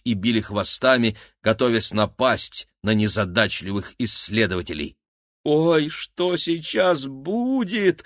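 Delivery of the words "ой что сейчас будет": high, very slow drawl; drawn out. A narrator's reading. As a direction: stretch every word out. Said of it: "протянул Максим".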